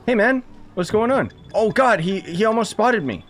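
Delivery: ordinary talk, nothing marked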